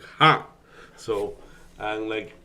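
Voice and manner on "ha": a short, loud, higher "ha" tacked on; imitating a rushed final "ha"; clipped ending sound. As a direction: mimic the abrupt "ha" at the end. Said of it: "at the end do you go like".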